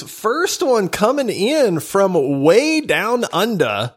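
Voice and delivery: hammed-up Australian accent, slow and high; slow mock-Australian announcement with swooping pitch; terrible Australian accent. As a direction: drawl it in a bad Aussie accent. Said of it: "that terrible, terrible accent".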